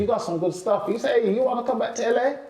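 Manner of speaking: quick, high, lively American invite; Bill Bellamy's invite. Retold or imitated. Comic retelling Bill Bellamy inviting him to LA, quick and high. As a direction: toss the offer off fast and high.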